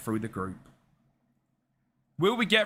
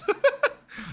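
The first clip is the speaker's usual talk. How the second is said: sudden high giggle; real high laugh; 4 dB louder than usual. Real, not acted. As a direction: burst of high-pitched laughter. Laughs at own story.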